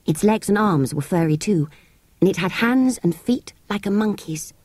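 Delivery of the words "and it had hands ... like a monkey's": ordinary delivery, nothing marked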